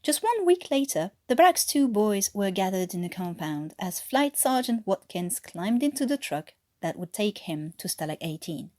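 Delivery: ordinary talk, nothing marked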